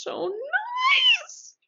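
squeaky high gush; high squeal. Acted. Gushing about Angela.

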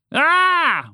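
high, loud scream; panicked scream. A narrator's reading. Frog finds a snake beside him, screaming. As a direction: shriek in alarm. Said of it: "Walter shouted".

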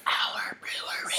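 soft, breathless whisper; hushed mock-snooty whisper; whispered aside. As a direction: whisper it like a secret.

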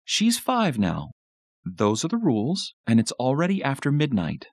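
measured, high motherly insistence; firm mother. A narrator's reading. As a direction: lay down the rules to the children. Mom character lays down the rules.

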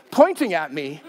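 ordinary talk, nothing marked

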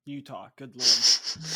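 exasperated self-correction. Real, not acted. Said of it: "I'm tired".

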